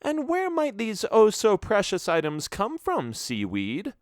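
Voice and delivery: sly mocking lady. A narrator's reading. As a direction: needle him with the nickname. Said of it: "woman needling the pirate".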